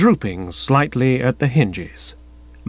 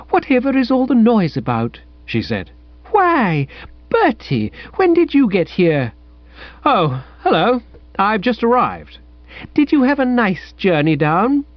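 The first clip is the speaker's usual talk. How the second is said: put-on high, soupy, wide-eyed girl; lightly voiced sugary girl, pitched high; Madeline's voice; 9 semitones higher than usual. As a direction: coo like a sentimental young woman.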